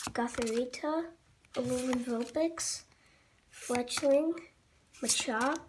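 ordinary talk, nothing marked

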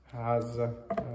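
usual speaking voice

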